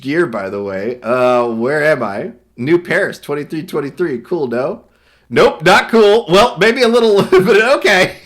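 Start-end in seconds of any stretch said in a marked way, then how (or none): 5.3-8.2 high, loud character voice. Acted. Reading character lines, louder.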